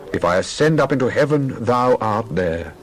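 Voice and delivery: ordinary delivery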